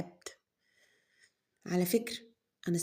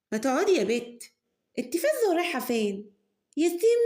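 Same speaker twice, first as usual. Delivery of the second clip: mother scolding; 8 semitones higher, 5 dB louder than usual. Acted. Mother scolds daughter rushing off.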